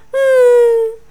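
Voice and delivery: high, loud held wail; drawn-out crying moan; loud sob. A narrator's reading. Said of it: "趴着哭".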